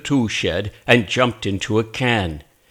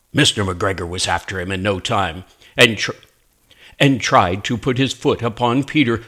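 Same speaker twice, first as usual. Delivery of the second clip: ordinary delivery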